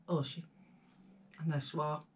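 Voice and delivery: normal talking voice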